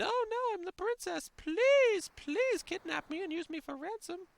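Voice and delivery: very high, breathy damsel squeal; Princess Lena voice. Retold or imitated. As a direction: squeal it like a helpless cartoon princess. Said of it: "That's my Princess Lena voice".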